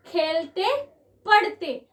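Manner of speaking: खेलते and पढ़ते each said slowly as a separate word, the ending ते stressed; खेलते, पढ़ते spoken apart to show the rhyme; stressed rhyme demo. Shown as a demonstration. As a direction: sound out खेलते, then पढ़ते, so the shared ending is heard. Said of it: "अगर ये आप लोग खुद से प्रोनाउंस करेंगे".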